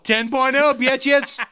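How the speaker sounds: loud, high, laughing cheer; excited boast. Real, not acted. Bragging to co-host about beer strength, loud boast. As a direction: crow it like a winning score.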